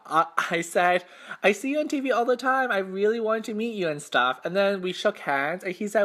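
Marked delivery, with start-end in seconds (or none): 1.4-4.4 high, fast starstruck babbling; retold nervous gush, words tumbling out; nervous chatter. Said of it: "when I get nervous, I talk a lot".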